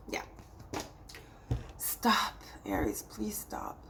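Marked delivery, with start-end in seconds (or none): 1.8-3.8 a soft unvoiced plea to stop; whispered plea. Real, not acted. Reader begging Aries to stop, whispered.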